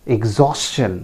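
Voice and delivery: exhaustion as ig-ZAWS-chun; correct pronunciation. Shown as a demonstration. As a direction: sound both S and CH in exhaustion. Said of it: "The correct pronunciation is". (exhaustion का सही उच्चारण, इग-ज़ॉस-चन)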